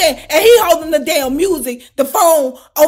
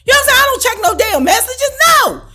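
indignant outburst; 10 semitones higher, 4 dB louder than usual. Retold or imitated. Retold snapping back at the man.

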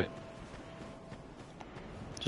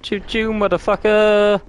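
high, drawn-out mock train hoot; playful train call; 10 semitones higher, slower than usual. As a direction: draw it out like a triumphant train driver.

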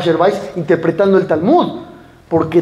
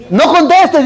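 higher, louder blurted advice; audience quip; 7 semitones higher, 5 dB louder, slower than usual. Retold or imitated. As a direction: call it out from the crowd. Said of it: "dice Isaac". (un oyente grita el consejo, más alto y fuerte)

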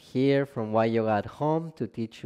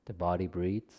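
calm, measured relaxation guidance, phrase by phrase; guided meditation; 3 dB quieter than usual. Acted. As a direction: guide them gently down into rest, unhurried and spacious. Leads a yoga nidra practice.

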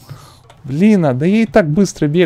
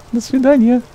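mock farewell; 10 semitones higher, 4 dB louder than usual. Mocking goodbye to the road.

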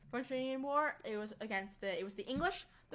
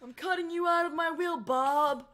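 higher, louder, hammed-up dramatic threat; soap opera drama; 6 semitones higher, 5 dB louder than usual. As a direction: overplay a soap opera rich relative. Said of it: "in the dramatic soap operas".

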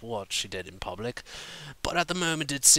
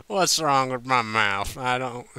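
louder, slurred and bewildered; drunken confusion; 3 semitones higher, 6 dB louder than usual. A narrator's reading. Applejack confused and drunk asking about friends, slurred. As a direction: fumble the words like a tipsy pony. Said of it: "Applejack said suddenly".

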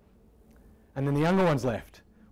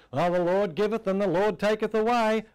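higher, louder, with a mock-sorrowful cry of "taketh away"; Job's lament; 7 semitones higher, 6 dB louder than usual. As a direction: quote Job as a wailing complaint, pitched up. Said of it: "all Job could see was".

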